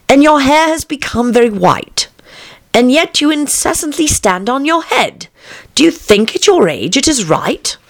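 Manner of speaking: put-on high, lilting girlish verse; poem recitation. A narrator's reading.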